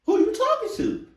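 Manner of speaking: very high, loud squawked question; indignant disbelief. Acted. Reactor as the one ordered about, loud high-pitched indignation. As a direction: shoot up high in mock outrage. Acted outrage at being ordered.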